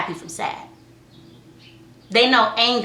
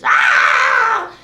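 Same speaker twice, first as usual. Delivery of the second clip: much higher, loud imitated toddler wail; mock crying wail; 8 dB louder than usual. Mom imitating her daughter bursting into tears, loud wail. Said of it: "She started busting up into tears".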